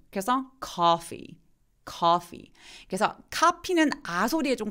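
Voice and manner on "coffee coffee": "coffee" with a long aw sound. Shown as a demonstration. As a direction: stretch the aw in "coffee". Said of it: "커피도 좀 길어요".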